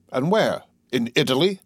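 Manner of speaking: usual speaking voice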